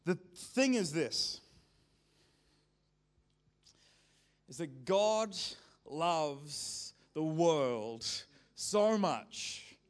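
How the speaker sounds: slow, loud, each phrase weighted; emphatic preaching. Real, not acted. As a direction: lean hard on "loves the world". Preacher building to key point.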